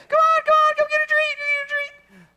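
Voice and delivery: very high loud coaxing; really high. Retold or imitated. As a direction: squeal it up high for the dog. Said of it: "you get really high".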